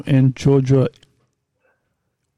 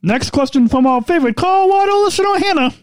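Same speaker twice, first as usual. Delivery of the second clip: high, bright announcer sing-song; radio voice; 12 semitones higher than usual. Pretend. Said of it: "my radio voice?".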